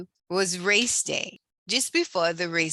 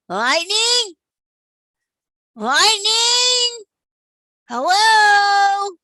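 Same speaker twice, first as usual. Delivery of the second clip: loud, high calling into the dark; loud worried calls; 12 semitones higher, 9 dB louder, much slower than usual. A narrator's reading. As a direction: shout for your lost friend. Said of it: "yelled Mater".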